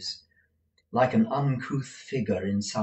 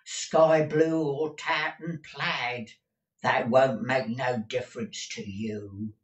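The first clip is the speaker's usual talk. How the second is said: put-on scornful old housekeeper; 4 semitones higher than usual. A narrator's reading. Deaf Peg belittling master's looks, scornful. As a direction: run him down at leisure.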